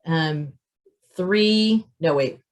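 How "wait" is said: ordinary talk, nothing marked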